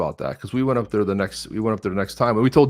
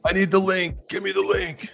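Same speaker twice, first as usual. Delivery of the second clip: higher-pitched mock begging; mock pleading; 6 semitones higher than usual. Teasing a guest who wanted the link, high mock begging. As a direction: beg urgently, as a teasing imitation.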